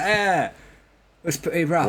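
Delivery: casual put-on English accent. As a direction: understate it in a British accent. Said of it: "And the, and the English".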